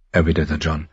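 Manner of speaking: ordinary delivery, nothing marked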